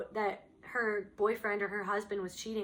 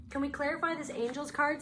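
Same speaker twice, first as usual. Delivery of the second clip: usual speaking voice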